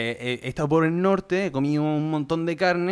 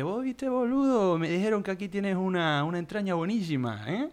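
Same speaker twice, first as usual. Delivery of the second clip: put-on Argentine accent; 3 semitones higher, 7 dB quieter than usual. Customer asks Argentine butcher for meat, fake Argentine accent. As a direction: put on a playful Argentine lilt. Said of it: "empecé a hablarle con, con acento".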